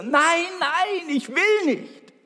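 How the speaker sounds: put-on very high, loud childish shriek of refusal; boy screaming again. Boy yelling at his mother in the pool, very high shriek. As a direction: scream it out, a panicked little boy. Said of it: "schrie er wieder".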